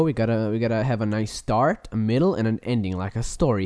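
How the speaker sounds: ordinary talk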